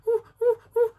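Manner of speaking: high, loud whimpering hoots; high-pitched dog whines; imitated dog crying. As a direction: mimic a frightened dog crying. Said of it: "he just started crying".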